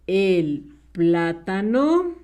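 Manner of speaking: very slow dictation, syllables stretched; dictating. Shown as a demonstration. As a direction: say it slowly as you write it out. Dicta mientras escribe. (dictando muy despacio, alargando las sílabas)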